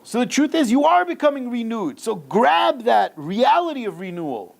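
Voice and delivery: high-pitched, rousing exhortation; impassioned sermon climax; fervent preaching. Real, not acted. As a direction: urge the listeners on with real fervour.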